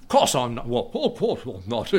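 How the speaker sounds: put-on laughing, posh English royal accent; mock English accent. Pastor mimics Prince answering, mock English accent. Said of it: "however he talks".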